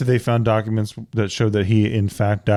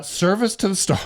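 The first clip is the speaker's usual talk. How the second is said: mocking snooty voice; 9 semitones higher than usual. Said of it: "She's like".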